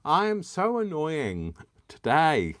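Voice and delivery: high, slow, drawn-out whine on so; hammed-up self-teasing moan; exaggerated self-mockery. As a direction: stretch the so in a mock whine. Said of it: "Shut up, [name]".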